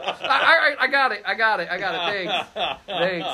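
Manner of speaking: sheepish mock impatience; embarrassed brush-off. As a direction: cut off a needless explanation. Reply to man demonstrating the lock.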